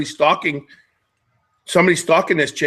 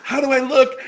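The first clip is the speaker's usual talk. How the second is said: mocking selfie girl; 10 semitones higher than usual. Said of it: "and you post it and go".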